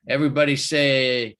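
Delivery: usual speaking voice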